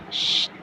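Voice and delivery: soft sharp shush for silence; hushing. Acted. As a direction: hush her quickly, barely voiced. Hushing friend at graveyard. (धीमी आवाज़ में चुप कराना)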